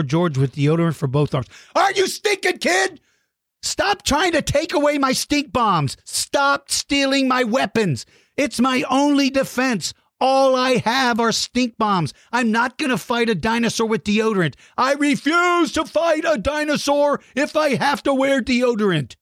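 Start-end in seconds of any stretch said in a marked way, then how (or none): 1.8-3.0 high cracking outraged cry; mock outrage. 3.6-19.2 mock whining.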